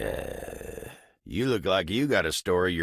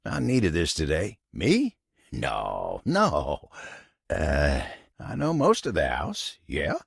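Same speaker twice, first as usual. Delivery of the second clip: ordinary talk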